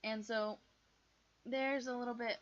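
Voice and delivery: usual speaking voice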